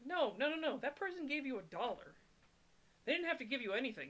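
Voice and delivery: high-pitched, insistent objection; emphatic protest. Real, not acted. Streamer objects to ungrateful donation-takers, emphatic protest. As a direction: push back firmly, pitch jumping up.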